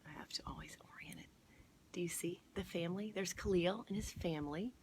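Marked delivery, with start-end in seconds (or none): 0.1-3.1 1 whispered aside. Real, not acted.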